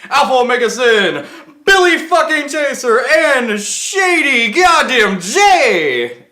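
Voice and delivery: booming wrestling ring announcer. Acted. Show intro announcer voice.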